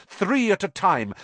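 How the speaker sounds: ordinary delivery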